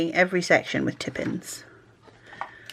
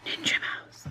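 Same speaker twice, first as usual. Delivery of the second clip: whisper; 11 dB quieter than usual. Real, not acted. Whispered label.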